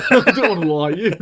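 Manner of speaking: amused, chuckling as you echo it; laughing quote. Retold or imitated. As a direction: repeat the line through a laugh. Friend repeats quote laughing.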